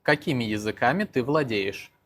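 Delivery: ordinary delivery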